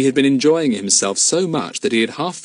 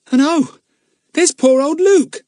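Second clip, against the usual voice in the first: high, loud dismayed cry; sudden pity, voice rising; 7 dB louder than usual. A narrator's reading. Pip dismayed at seeing Luke.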